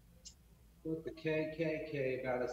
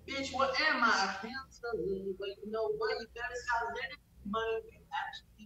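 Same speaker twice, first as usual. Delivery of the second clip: hillbilly voice; 5 semitones higher than usual. Pretend. Teen livestream song done in hillbilly twang. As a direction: drag out the threat like a mock hillbilly. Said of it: "Suck it, hillbilly ass voice".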